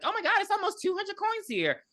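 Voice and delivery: squealing high with surprise; a girl counting coins in shock; amazed. Retold or imitated. Woman counting the money, shrill high amazement. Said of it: "She's like".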